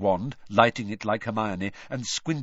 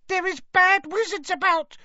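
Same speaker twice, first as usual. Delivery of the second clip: very high, squeaky elf voice; tiny house elf squeaking in panic; 4 dB louder than usual. A narrator's reading. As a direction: squeak it out, frightened and distracted. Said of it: "she squeaked distractedly".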